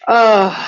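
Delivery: a loud open yawn slipping out. Real, not acted. Tapping teacher yawning during the session, loud yawn. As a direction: let a big yawn escape mid-guidance. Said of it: "I am yawning and burping".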